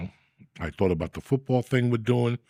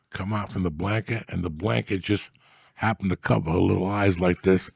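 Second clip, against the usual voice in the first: usual speaking voice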